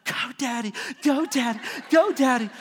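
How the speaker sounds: lightly voiced daughter cheering; little cheerleader. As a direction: chant it like a small cheerleader, building. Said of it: "she's a little cheerleader".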